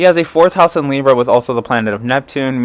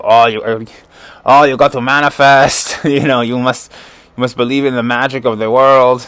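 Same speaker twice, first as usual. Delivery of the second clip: Arnold Schwarzenegger impression. Arnold lecturing fans about manifesting, in a mild Austrian accent. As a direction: preach it in a hammed-up Austrian accent. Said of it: "That's my Arnold Schwarzenegger impression".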